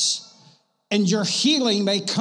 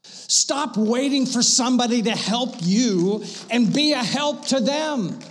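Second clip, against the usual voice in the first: full-force preaching; 4 semitones higher than usual. Acted. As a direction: push it out to the whole room.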